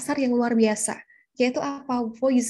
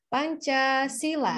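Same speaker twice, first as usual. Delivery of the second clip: "Pancasila" drawn out slowly, falling at the end; ceremonial recital intonation; much slower than usual. Shown as a demonstration. Reciter at school reading Pancasila, slow ceremonial intonation. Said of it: "intonasinya kayak".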